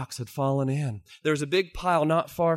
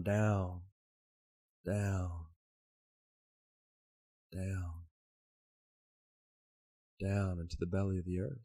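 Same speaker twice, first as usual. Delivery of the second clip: a hushed fade as she goes deeper; fading descent; 5 semitones lower, 6 dB quieter than usual. A narrator's reading. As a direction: let the words sink into the dark.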